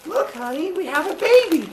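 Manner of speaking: put-on bright little announcement of the baby; sweet Rhea voice. Wife presenting the newborn to her husband, high and sweet.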